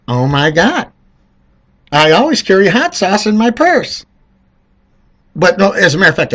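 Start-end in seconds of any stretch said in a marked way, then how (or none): 0.1-4.1 higher mocking imitation; mock Hillary. Mocking Hillary's line to voters, higher mock voice. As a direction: lampoon her pandering. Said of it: "Hillary's like".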